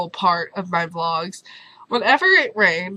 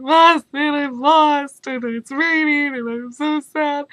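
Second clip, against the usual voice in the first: high, loud whiny wail, hammed up; mock crying; 5 semitones higher, 3 dB louder, slower than usual. Lost in the rain phoning her advisor, mock crying. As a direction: imitate a tearful plea for help. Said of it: "Crying. Like, full-on tears".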